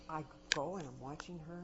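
usual speaking voice

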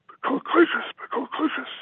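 real tears breaking through the words; choked up. Real, not acted. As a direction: voice cracking high into a cry. Speaker chokes up in eulogy.

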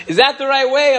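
calling out loud and high across the airport; shouted call. Retold or imitated. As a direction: yell it to someone far off. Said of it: "a voice just screaming".

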